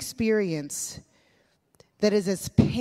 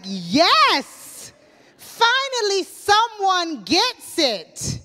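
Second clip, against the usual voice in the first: loud, high, slow exultant "finally"; relieved delight; 10 semitones higher, 6 dB louder, much slower than usual. Retold or imitated. Preacher recalling her delight at feeling understood, loud and high. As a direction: cry it out in joyful relief. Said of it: "I was like".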